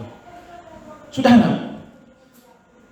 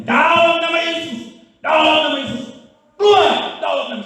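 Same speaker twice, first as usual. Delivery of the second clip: exorcist's shout; 11 semitones higher than usual. Retold or imitated. Preacher re-enacting casting out a demon, high forceful shouting. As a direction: order the demon out at full voice. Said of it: "saya mulai teriak".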